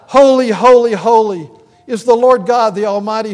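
loud, ringing cry of holy, holy, holy; angels crying out. Retold or imitated. Preacher voicing angels crying out before God, loud and ringing. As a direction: proclaim it out loud like the angels in worship. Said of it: "They cry out".

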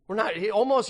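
ordinary delivery

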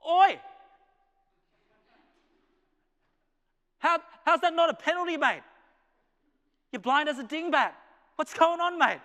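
high, loud heckling at the umpire; outraged fan yelling. Acted. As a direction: act out an indignant Aussie footy supporter shouting. Acts footy fan yelling at umpire.

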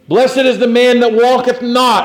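high, emphatic preacher's recitation; scripture recitation. Acted. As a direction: declaim the verse to the congregation.

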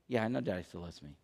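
very low, hushed, quick answer; small, quiet mumble imitating a child; meek child's reply. Son answers dad's question, meek and very quiet.

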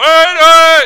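put-on very high, loud screaming child; mock child scream.

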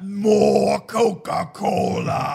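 slow, loud creature moan; imitated zombie-like alien groan; mock menacing alien. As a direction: drag it out like a hungry monster. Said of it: "expecting them to be like".

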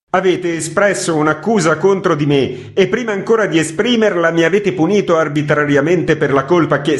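loud, high indignant protest. A narrator's reading. Editor defending himself to Gvozdev, loud and high. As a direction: demand to be heard, voice up. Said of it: "alzando la voce".

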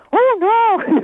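a hammed-up "oh no" for the calm acupuncturist; playful imitation of a barely ruffled woman, pitched high; mock upset. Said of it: "she'll get really upset".

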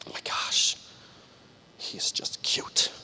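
put-on whispered girlish confiding; gushing whisper. Girl confiding to her friend about a cute guy, gushing whisper. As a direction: whisper it like a secret crush.

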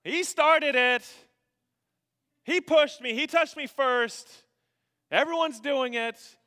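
put-on high, loud child's protest; whining kid. Kids giving parents excuses, whining kid protest. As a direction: blame the other kid in a drawn-out wail. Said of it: "Our kids give excuses all the time".

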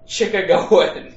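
put-on a weird stab at a Chicago accent; mock accent read off a card. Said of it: "I was like".

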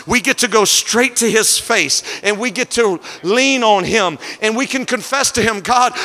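climbing sermon cadence over the organ; rising pulpit fervor, held strong; preaching at full force. Acted. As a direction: preach it home to the congregation. Preaching to congregation with music.

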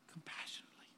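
breathy whisper of one word. Real, not acted. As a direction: let it fall to a hush.

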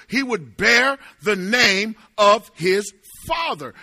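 loud, punched key words; forceful preaching. Real, not acted. Preacher telling how the relatives gathered, loud and emphatic.

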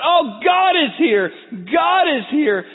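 high, loud jubilant cries; tears of joy. Acted. Family whose grief turned to joy, loud elated cries. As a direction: cry out in overwhelmed joy. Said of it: "now turned to tears of joy".

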